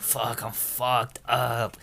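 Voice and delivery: imitating a wasted friend, very slow; drunk groan. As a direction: stretch it out like you're wasted. Drunk friend head on table.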